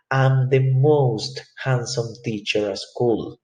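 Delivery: slow, carefully spoken English; teacher modeling an English sentence; English example sentence. Shown as a demonstration. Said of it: "Por ejemplo".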